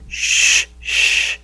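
soft, drawn-out "shh"; imitated shushing. Crowd to the yelling beggar, imitated shushing. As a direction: hush someone softly with pure breath.

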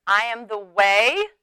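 slow, measured recitation; slow, solemn unison reading; congregational recitation. Real, not acted. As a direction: lead the room in reciting scripture. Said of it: "say it with me".

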